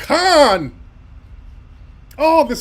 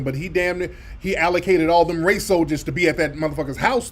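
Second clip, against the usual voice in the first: ordinary delivery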